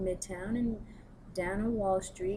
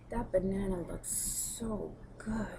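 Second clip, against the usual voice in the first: mock flirty client; 3 dB quieter, slower than usual. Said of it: "and she goes".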